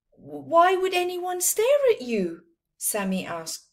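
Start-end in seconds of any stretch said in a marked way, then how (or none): 0.1-2.4 puzzled question. Acted. Puzzled friend asks.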